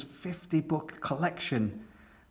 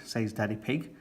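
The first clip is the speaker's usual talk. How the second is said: ordinary delivery, nothing marked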